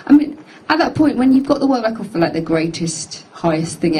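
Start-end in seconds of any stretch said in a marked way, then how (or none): none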